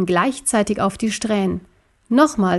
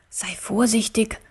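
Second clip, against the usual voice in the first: hushed, softer caution; murmured warning; 5 dB quieter than usual. Acted. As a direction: warn your friend under your breath. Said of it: "raunte ihm Wuff zu".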